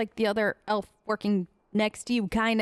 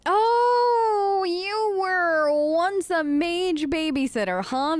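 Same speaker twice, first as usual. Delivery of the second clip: teasing; 6 semitones higher, 4 dB louder, much slower than usual. Real, not acted. Teasing Alistair about being a Templar.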